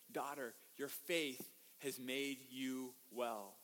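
slow, soft, low blessing; acted tender, unhurried comfort; tender reassurance. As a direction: speak gently, as to a daughter. Jesus tenderly addresses the woman.

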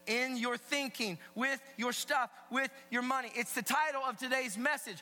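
high, loud sermon voice; emphatic preaching. Real, not acted. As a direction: preach it to the whole room with punch. Preaches at full voice.

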